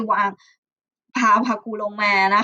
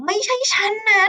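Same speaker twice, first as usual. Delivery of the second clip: higher-pitched, lightly acted snap back; storybook warning tone, pitched up; threatening retort; 9 semitones higher, faster than usual. A narrator's reading. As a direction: deny it with mild menace. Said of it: "ทำเสียงขู่นะคะ".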